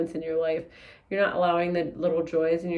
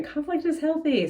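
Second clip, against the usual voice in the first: Conflict is healthy, pitched much higher with IS stressed; high emphatic repeat; 9 semitones higher than usual. Shown as a demonstration. Reader repeating her message to viewers, high and emphatic. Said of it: "That's what I'm hearing".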